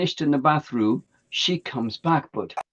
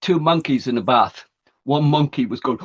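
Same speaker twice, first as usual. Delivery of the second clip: ordinary delivery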